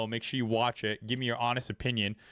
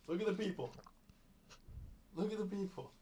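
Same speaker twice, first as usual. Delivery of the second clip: soft, higher pet talk; talking to dog; 8 semitones higher, 12 dB quieter, faster than usual. Real, not acted. Streamer showing his dog the chat, soft and higher. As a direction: show the dog the viewers sweetly.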